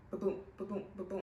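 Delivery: a gentle heartbeat sound made with the mouth; imitated heartbeat. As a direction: mimic a pulsing heartbeat. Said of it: "the heartbeat is like".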